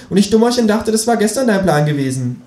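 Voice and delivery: high, cheeky kangaroo voice. Acted. Reading aloud, the narrator voices the Kangaroo asking him to pay, high and cheeky.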